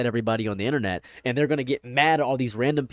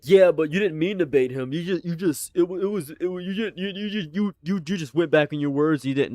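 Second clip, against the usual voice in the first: mimicking accusers; 3 semitones higher than usual. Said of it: "People were like".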